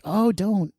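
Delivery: high-pitched retold "please don't"; light, high mock dismay. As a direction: jump up high, as if begging them off. Retold reaction to a new listener.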